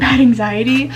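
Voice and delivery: normal talking voice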